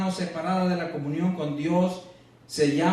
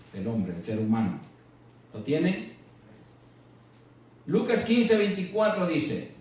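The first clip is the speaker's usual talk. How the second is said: normal talking voice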